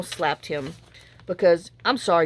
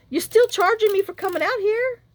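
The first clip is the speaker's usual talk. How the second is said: indignant; 12 semitones higher than usual. Real, not acted. Indignant aside about the charge.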